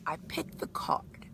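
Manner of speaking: slow, unvoiced hush; slow dramatic whisper before the reveal. Real, not acted. Hushed reveal moment.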